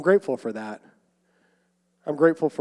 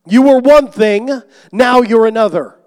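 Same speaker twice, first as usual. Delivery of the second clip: emphatic preaching; 7 semitones higher, 13 dB louder than usual. Acted. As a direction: hammer the repeat home even harder. Preaching climax, repeated.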